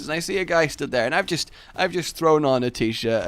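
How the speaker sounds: usual speaking voice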